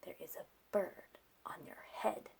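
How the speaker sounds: soft closing whisper; whispered title. Acted. Narrator closing the book, whispered title.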